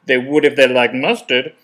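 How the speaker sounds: cheeky trainee pressing the instructor with a loud gotcha; smug trainee. Retold or imitated. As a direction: toss out the gotcha, pleased with yourself. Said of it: "raises their hand and goes".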